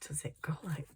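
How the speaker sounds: whispered to herself, puzzled. Real, not acted. Puzzling over the fold.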